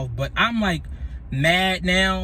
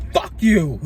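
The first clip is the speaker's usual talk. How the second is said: angry snap; 4 dB louder than usual. Retold or imitated. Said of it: "I snatched the shit".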